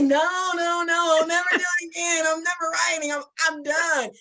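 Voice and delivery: high, fast hammed-up panic; mock despair. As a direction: play up the refusal like a scared writer. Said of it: "I'm like".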